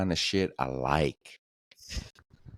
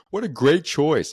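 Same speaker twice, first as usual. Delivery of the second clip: high, loud gushing server voice; mock a waitress who is far too peppy; imitating a chirpy waitress praising an order; overenthusiastic waitress; 10 semitones higher, 5 dB louder than usual. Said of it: "too enthusiastic".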